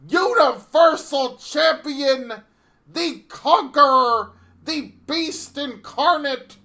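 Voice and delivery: Paul Heyman. Acted. Advocate introducing the new undisputed champion, loud and drawn out. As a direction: draw out each title like a bombastic ring announcer. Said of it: "my name is Paul Heyman".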